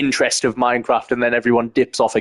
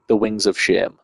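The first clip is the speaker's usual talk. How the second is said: hushed dramatic whisper; 2 semitones lower than usual. Acted. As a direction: breathe it out in a whisper. Dramatic aside about the elytra.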